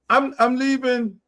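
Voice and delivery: ordinary talk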